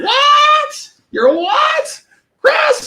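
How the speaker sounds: shrill high mock mom shouting; imitating mom. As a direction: squeal it like a confused mom. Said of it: "that's pretty much what my mom does".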